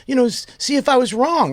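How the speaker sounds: high, pleading run-on explanation; mock-upset voice, pitched well up; imitated crying. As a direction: mimic a tearful woman pouring out her story. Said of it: "she starts crying".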